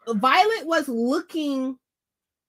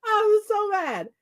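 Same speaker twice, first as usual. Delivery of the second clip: mock whiny upset; 7 semitones higher than usual. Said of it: "I was like".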